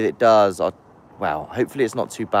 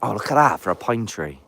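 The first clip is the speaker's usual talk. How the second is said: ordinary delivery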